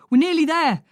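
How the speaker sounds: loud, high call into the phone; shouting. Acted. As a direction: call it out over the engine. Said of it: "Ollie shouts".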